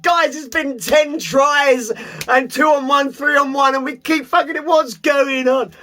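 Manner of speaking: high, slow exasperated plea to teammates; pleading with frustrated disbelief. Retold or imitated. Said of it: "And you're like".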